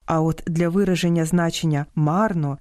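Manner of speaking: normal talking voice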